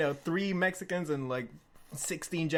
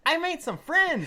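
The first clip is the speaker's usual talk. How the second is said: put-on much higher, slow dopey drunk voice; goofy drunk; 12 semitones higher, 6 dB louder than usual. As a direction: play the grinning drunk friend. Drunk Chuck returns grinning.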